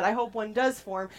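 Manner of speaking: usual speaking voice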